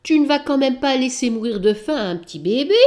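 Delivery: cat reproaches the witch, indignant. Acted. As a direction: scold her for her heartlessness. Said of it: "s'indigne Balthazar".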